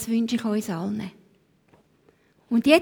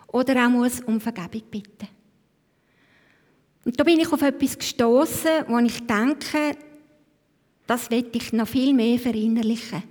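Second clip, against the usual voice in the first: ordinary talk, nothing marked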